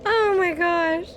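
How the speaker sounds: high, slow, half laughing half crying; laugh-crying frustration. Real, not acted. Gamer losing to a boss, laugh-crying. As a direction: wail it out between laughs.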